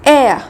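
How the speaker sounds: "air" said loud and high as eh-uh; diphthong demo. Shown as a demonstration. Teacher calling out the diphthong to the class, loud and clear. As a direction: glide eh into uh, loudly. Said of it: "the next diphthong is".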